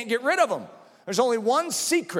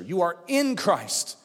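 slow line with "in" punched; deliberate weight on "in"; stress on "in"; 4 semitones lower, slower than usual. Real, not acted. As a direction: hit the word "in" and draw it out. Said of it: "I emphasize the word in".

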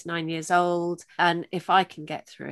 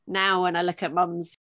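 usual speaking voice

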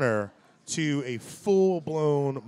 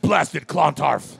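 slow, louder mock Klingon oath; Klingon curse; 6 dB louder, much slower than usual. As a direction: growl it like an alien curse. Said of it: "sounds like a Klingon swear word".

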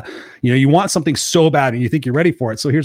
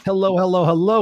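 upbeat announcer welcome, higher pitch; show host intro; 5 semitones higher than usual. Acted. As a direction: open the show like a hyped host. Podcast host opening the show.

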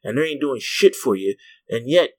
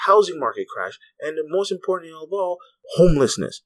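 ordinary talk, nothing marked

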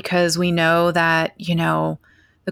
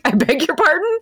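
high, affronted "excuse me?"; offended surprise; 10 semitones higher than usual. Retold or imitated. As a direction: act taken aback by the remark. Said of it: "And I was like".

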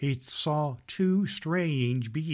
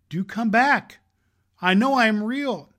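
higher, louder plea; pleading call; 8 semitones higher, 7 dB louder than usual. Acted. Little rabbit pleading after the vanished rabbits, high and loud.

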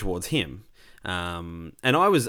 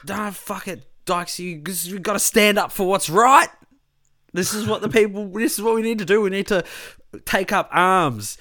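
high, louder, hammed-up call to arms; mock rallying tirade; 10 semitones higher, 4 dB louder than usual. Drunken rant urging his mate to fight back, hammed-up. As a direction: rouse the crowd, half joking. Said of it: "I went on this long tirade".

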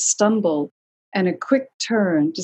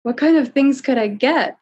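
higher, louder whine turning eager; eager whining boy; 7 semitones higher, 5 dB louder than usual. A narrator's reading. Boy suddenly interested in treasure, eager whine. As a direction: perk up like a greedy little boy. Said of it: "the first sounds of eagerness".

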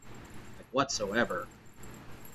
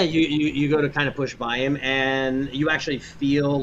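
normal talking voice

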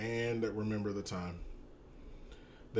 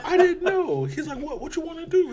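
way higher and louder, laughing through it; high mock quote; 9 dB louder than usual. Retelling a movie argument to a co-host, high and loud.

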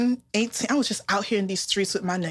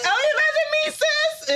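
pleading much higher in pitch, imitating herself; high mock plea. Texting her friend from a bad date, high mock plea. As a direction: squeak it out as a mock cry for help. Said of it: "I was like".

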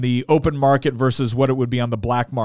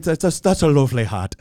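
a hint of an Irish accent, imitating. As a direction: repeat the lady's compliment in a light Irish accent. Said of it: "forgive my horrible accent here".

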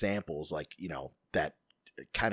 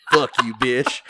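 loud, raised mock insult; jokey loud cursing; 4 semitones higher, 11 dB louder than usual. Tells old teacher off if still alive, loud jokey curse. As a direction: holler it at the absent teacher.

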